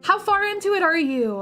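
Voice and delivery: high bubbly welcome voice; cute anime streamer. Acted. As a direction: squeal a bright greeting to the raiders. Excited raid greeting in cute voice.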